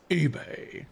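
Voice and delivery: hushed, drawn-out character reply; acted movie reply. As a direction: answer quietly in a stretched, put-on hero voice. Answers as Optimus in movie quote.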